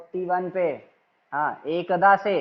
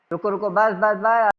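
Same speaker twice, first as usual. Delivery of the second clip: urgent stop; 4 semitones higher, 8 dB louder than usual. Real, not acted. Teacher stops student reading.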